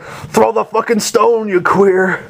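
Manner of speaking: a hint of a fed-up giant turtle; imitating Gamera losing patience with the kid; annoyed Gamera. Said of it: "And Gamera's like".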